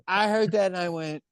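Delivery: ordinary talk, nothing marked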